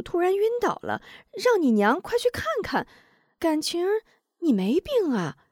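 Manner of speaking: high voiced old neighbor woman, puzzled; a chatty puzzled village aunt, pitched high. A narrator's reading. Narrator voicing the neighbor aunt to Wu Zhong, high puzzled.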